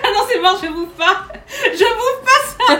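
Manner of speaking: high, loud laughing refusal; laughing protest. Real, not acted. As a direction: squeal no through laughter. Tasting spicy dried octopus, refusing.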